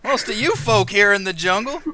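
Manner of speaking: put-on folksy lizard-folk country accent; country rancher accent. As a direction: retort in a high rancher accent. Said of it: "speaks quite like you all".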